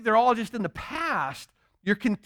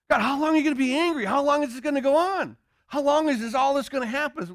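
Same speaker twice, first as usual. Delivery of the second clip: high, fast complaining plea to God; whiny pleading believer; 7 semitones higher, faster than usual. Acted. Believer pleading impatiently with God, high and fast. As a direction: rush it out like a nagging child asking when it ends. People whining to God, acted.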